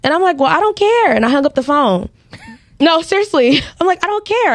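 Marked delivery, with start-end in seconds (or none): 0.4-1.1 high, flippant "I don't care"; dismissive. Retold or imitated. Dismissing caller before hanging up. 4.0-4.5 high, flippant brush-off; dismissive. Retold or imitated. Retelling hanging up on him.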